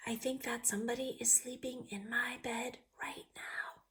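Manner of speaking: unvoiced whisper. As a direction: whisper so the bear won't hear. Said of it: "whispered the little person".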